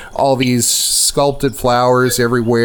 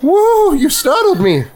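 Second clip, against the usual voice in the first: put-on startled old lady. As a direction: jolt back like a frightened granny.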